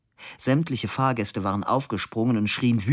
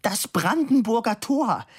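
high-pitched indignant cry; high shout, near tears; screaming; 13 semitones higher, 3 dB louder than usual. A narrator's reading. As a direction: cry it out in exasperation. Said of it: "schrie die dicke Dame".

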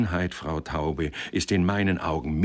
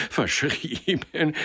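much higher, slow mocking repeat; scornful echo; 8 semitones higher, much slower than usual. Boss mocking the clerk's excuse, scornful. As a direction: throw the word back at him.

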